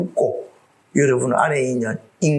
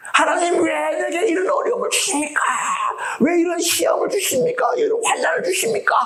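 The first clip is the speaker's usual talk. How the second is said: anguished complaint to God. Pretend. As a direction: cry out to God in mock despair.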